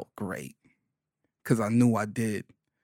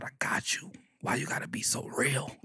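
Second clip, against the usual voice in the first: soft whisper; whispered reply; 11 dB quieter than usual. Retold or imitated. Answering his morbid mama, whispered. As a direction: hush it back to her.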